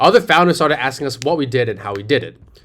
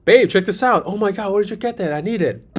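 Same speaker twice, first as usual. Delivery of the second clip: put-on higher, gushing girlfriend voice; excited friend; 8 semitones higher than usual. Friend showing off a new outfit, excited and higher. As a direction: rave about the find to your friend. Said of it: "one of the viral bestseller ads".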